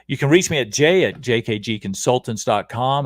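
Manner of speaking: ordinary talk, nothing marked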